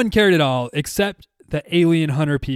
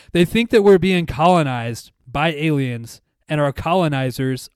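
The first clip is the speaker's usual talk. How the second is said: usual speaking voice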